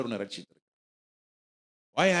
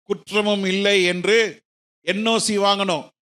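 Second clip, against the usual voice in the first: loud, raised-pitch sermon delivery; forceful preaching; 6 semitones higher, 12 dB louder than usual. Acted. Preacher tells the congregation about tax clearance for visas, forceful preaching.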